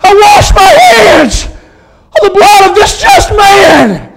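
high, slow anguished scream; Pontius Pilate screaming. Acted. Condemned governor washing his hands in hell, anguished screaming. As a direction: wail the disclaimer at full force. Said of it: "still screaming in hell".

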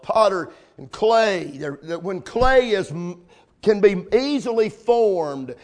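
ordinary talk, nothing marked

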